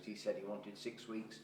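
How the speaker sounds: ordinary talk, nothing marked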